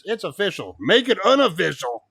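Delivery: high, loud protest; mock outrage. Fan answers the manager's tweet, mock outrage. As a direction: read it out, then loudly demand the reverse. Reacting to tweet announcing match.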